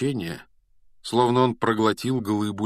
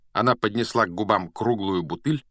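plain reading voice

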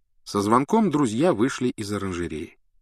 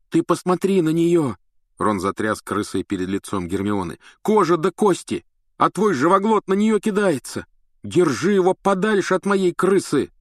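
angry shout; 9 semitones higher, 4 dB louder than usual. A narrator's reading. Ron angrily shaking the rat.

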